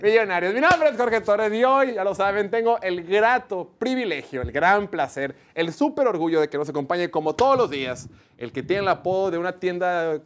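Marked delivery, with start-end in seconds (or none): 0.5-8.1 loud, grand announcer build-up; booming host intro. Acted. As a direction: hype up the guest like a ring announcer.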